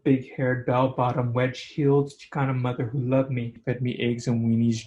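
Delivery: ordinary delivery, nothing marked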